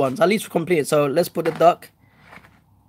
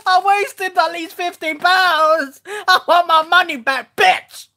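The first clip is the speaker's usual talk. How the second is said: angry shouting; 10 semitones higher, 3 dB louder, slower than usual. Real, not acted. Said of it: "sorry for the shouting".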